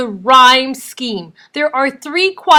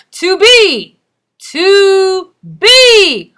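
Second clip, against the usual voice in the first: "to be" with "be" much louder and higher; slow, loud "to BE", second word punched; stress on BE; 9 semitones higher, 9 dB louder, much slower than usual. Shown as a demonstration. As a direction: exaggerate the iamb in "to be", lighter "to", booming "be". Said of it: "notice which one is louder".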